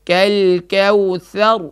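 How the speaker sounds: drawn-out melodic recitation; slow Quran recitation. Shown as a demonstration. Teacher leads the student line by line, slow chant. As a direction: stretch each word slowly for the student to repeat. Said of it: "میرے ساتھ پڑھیے".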